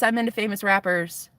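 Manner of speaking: ordinary talk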